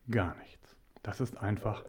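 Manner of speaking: plötzlich geflüstert, ganz leise; whisper. Real, not acted. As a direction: hauche die Worte nur. Leise Beteuerung, kein Egoismus. (geflüstert, eindringlich leise)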